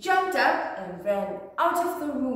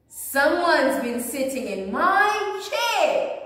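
growling; 5 semitones higher, 7 dB louder than usual. A narrator's reading. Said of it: "growled Papa Bear".

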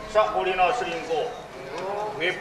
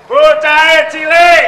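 high, very slow proclamation; loud declamation; 7 semitones higher, 11 dB louder, much slower than usual. Acted. Regent listing the prit gandil woman's traits, declaiming slowly.

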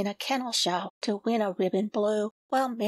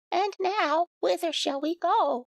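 higher, lightly voiced gander; gander's voice; 7 semitones higher than usual. A narrator's reading. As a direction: ask brightly as the goose. The gander speaks.

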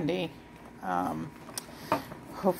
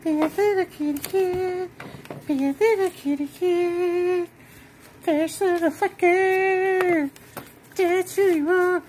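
put-on baby talk; 12 semitones higher, 4 dB louder than usual. Talking baby talk to her kitten. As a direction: coo it up high, like to a baby.

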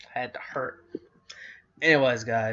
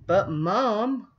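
higher, louder pleading whine without crying; whining; 9 semitones higher, 7 dB louder than usual. A narrator's reading. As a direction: drag out a sulky plea. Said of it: "Lindy whined unhappily".

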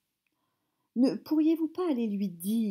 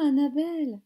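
usual speaking voice

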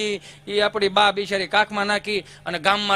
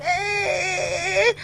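mock crying child; 10 semitones higher than usual. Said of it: "ત્યાં સુર મારો તું".

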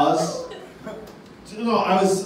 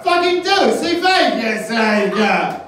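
put-on loud slurred drunk Boston accent, higher; 4 semitones higher, 4 dB louder, slower than usual. Drunk Bostonian thanking Mark Wahlberg, loud slurred Boston accent. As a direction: bellow it like a drunk Boston fan. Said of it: "a drunk Boston accent".

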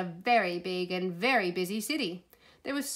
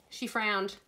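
ordinary delivery

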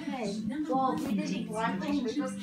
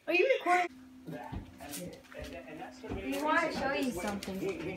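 ordinary talk, nothing marked

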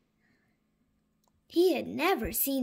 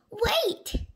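urgent whisper; 11 semitones higher, 3 dB louder than usual. Acted. Danny stops dinosaur eating grass.

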